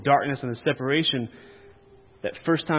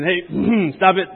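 loud, higher, commanding interjection; calling to attention; 6 semitones higher, 9 dB louder, much slower than usual. Acted. Preacher acting Paul addressing Corinth, loud call to attention. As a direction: call a rowdy group to order. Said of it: "call to attention".

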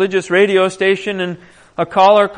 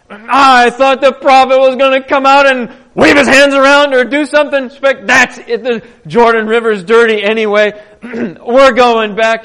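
loud, higher, huffy complaint; a proud commander who feels insulted; louder indignant rant; indignant outburst; 4 semitones higher, 6 dB louder than usual. Retold or imitated. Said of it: "He is indignant".

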